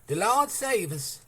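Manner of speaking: high, loud and slow terror; screamed prayer. A narrator's reading. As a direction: cry it out in fear. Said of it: "Lucy screamed".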